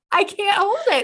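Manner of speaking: high, strained mock panic; a hint of panic; desperate. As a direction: act out the desperate need to go. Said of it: "I was like".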